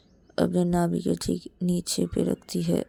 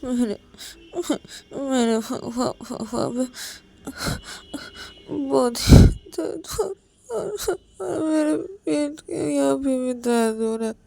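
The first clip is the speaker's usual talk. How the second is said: tearful; 8 semitones higher, 4 dB louder than usual. Acted. Payal crying about pain.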